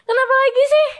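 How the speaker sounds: put-on high, loud annoyed snap at the crying girlfriend; irritated snap. Woken by her crying, he snaps at her loudly. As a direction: bark it out, fed up and sleepy. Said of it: "agak nyentak gitu kan".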